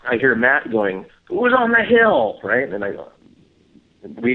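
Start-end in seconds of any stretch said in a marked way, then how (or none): 1.3-2.4 high, loud call up into the dark; imitating a call. Matt calling up the hill at night, high loud call. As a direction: shout it toward the hill to find who's there.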